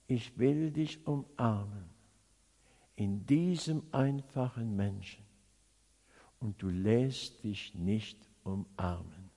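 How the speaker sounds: low, soft, measured voice of the Lord; tender reproach. Retold or imitated. God's gentle reproach, quoted softly.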